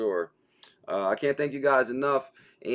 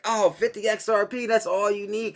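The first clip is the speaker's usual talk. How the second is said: higher, sing-song mocking taunt; 9 semitones higher than usual. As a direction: poke fun like a heckler. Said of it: "They was like".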